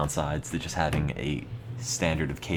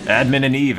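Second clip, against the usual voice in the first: loud pun; 6 semitones higher, 7 dB louder than usual. Real, not acted. Pun joke shouted.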